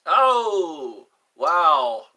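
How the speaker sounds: loud, stretched out oh wow; a loud amazed wow at chat; loud surprised oh wow. Real, not acted. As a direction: blurt out a big amazed wow. Reacting to chat message.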